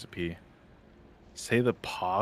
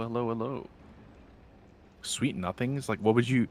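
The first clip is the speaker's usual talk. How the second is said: ordinary talk, nothing marked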